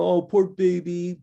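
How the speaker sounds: put-on drawn-out babying jeer; teasing. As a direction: imitate a bully cooing with fake sympathy. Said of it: "and they tease them".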